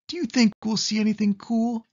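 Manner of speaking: high, quick little cat voice; Pete the cat voice. Acted. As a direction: make Pete sound small and eager. Pete's line in a picture book.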